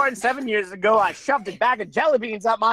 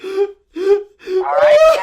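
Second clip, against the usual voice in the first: high squeal; 9 semitones higher, 7 dB louder than usual. Pretend.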